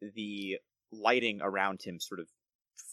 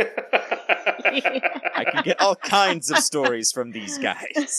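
delighted, slow words through laughter, much higher and louder; laughing lord; 10 semitones higher, 9 dB louder, much slower than usual. Acted. Gerald laughs at frog story.

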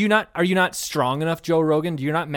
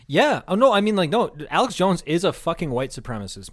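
ordinary talk

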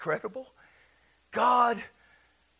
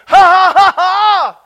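high, booming ha-ha laugh; deliberate loud laughter; 11 semitones higher, 21 dB louder, slower than usual. Shown as a demonstration. Preacher getting people laughing, loud deliberate laugh. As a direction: force out a loud run of laughs. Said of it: "practice some laughing".

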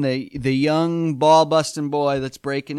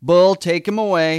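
loud, raised courtroom command; mock judge's order; 4 semitones higher, 8 dB louder than usual. Judge has bailiff remove the dad, mock commanding.

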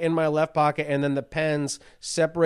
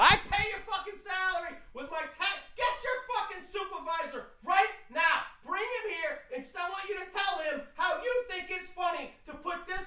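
high, strained scream held back in volume; distant, muffled screaming fit; mock screaming tirade; 14 semitones higher, 11 dB quieter than usual. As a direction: rant furiously in a soft, high-pitched mock yell. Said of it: "I'm screaming".

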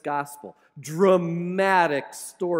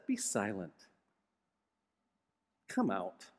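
very low, quiet, firm order; calm authority that silences a demon; stern command; 10 semitones lower, 12 dB quieter than usual. Acted. Preacher voicing Jesus rebuking the spirit, low and firm. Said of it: "So Jesus just says".

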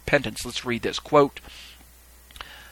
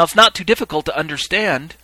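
usual speaking voice

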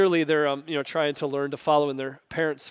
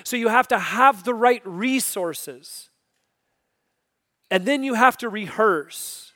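preaching; 6 semitones higher, 7 dB louder than usual. Acted. Preaching at full force.

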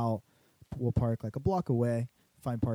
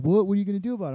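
mildly mad retort, jumping higher; mock angry challenge; 8 semitones higher, 6 dB louder, much faster than usual. Driver challenged over a stolen parking spot, imitated mildly mad. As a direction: imitate a guy getting defensive, pitched up. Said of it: "he got mad".

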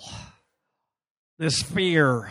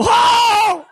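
high, loud laughing ho; bursting out like a man overcome; mock holy shout; 10 dB louder than usual. Imitating the tent preacher, loud ho shouts. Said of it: "an arm would go".

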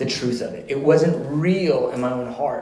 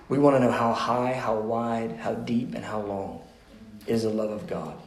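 unhurried prayer, sinking softer and lower; 3 semitones lower, 4 dB quieter than usual. Real, not acted. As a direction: pray it gently, pausing between petitions. Leads the closing prayer.

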